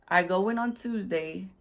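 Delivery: ordinary delivery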